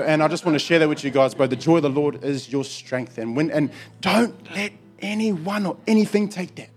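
ordinary talk, nothing marked

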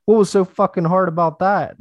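very high, loud mock-incredulous crowing; gloating taunt. Climber to his friend after nailing the landing, gloating taunt. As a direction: crow it back at a doubting friend.

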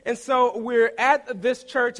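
normal talking voice

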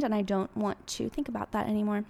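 ordinary talk

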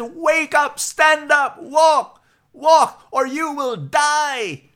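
very high, loud, desperate pig yell; shouting pig. Acted. Pig lands on the horse and yells at it to walk, high desperate shout. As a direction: scream it at the horse's ear, all-out.

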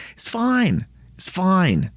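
high-pitched, dismissive mock reassurance. False teachers excusing sin to their followers, mock reassurance.

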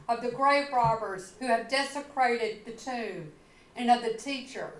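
usual speaking voice

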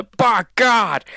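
high, excited commentator's exclamation; Jim Ross. Retold or imitated. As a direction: shout it like a wrestling announcer. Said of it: "it is Jim Ross for me".

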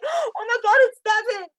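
high, loud cry with a gasp; gasp, then cry the name out. Real, not acted. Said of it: "I'm gonna get emotional".

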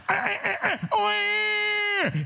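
hammed-up infant cry for the breast; baby wail. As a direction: wail like a hungry baby at night. Imitates baby crying, dirty diaper.

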